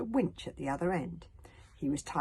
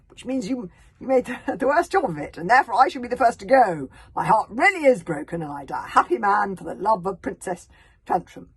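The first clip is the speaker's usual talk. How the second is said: grand boast; 4 semitones higher, 12 dB louder than usual. Acted. Noble fiancé boasts loudly.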